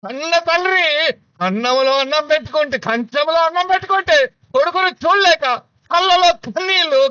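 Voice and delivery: high, loud, ringing litany of refusals; full-force preaching. Acted. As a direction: preach it with rising fire. (ఉద్రేకంతో బోధించే గట్టి స్వరం)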